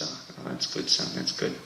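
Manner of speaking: low hushed gruff dad; a hint of a mumbling farmer dad; dad's response. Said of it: "he goes".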